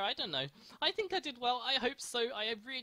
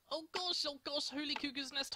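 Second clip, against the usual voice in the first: ordinary talk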